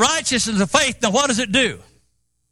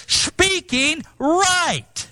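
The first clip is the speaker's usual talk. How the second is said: very slow, stretched words; drawn out; 5 semitones higher, much slower than usual. Shown as a demonstration. Preacher stressing right speaking to the church, very slowly. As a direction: draw out every syllable. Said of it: "in other words".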